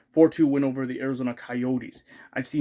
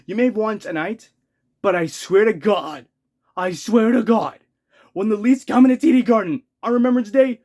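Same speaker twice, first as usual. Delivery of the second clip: loud, high shouted vow; furious threat; 4 semitones higher, 4 dB louder than usual. Real, not acted. Fan warning the Bruins they'll pay, shouting. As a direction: swear revenge at the top of your voice.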